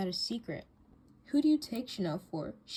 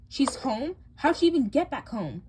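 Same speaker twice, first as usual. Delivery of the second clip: high, loud startled question; can't believe what she hears; stunned surprise; shocked disbelief; 6 semitones higher, 8 dB louder than usual. Acted.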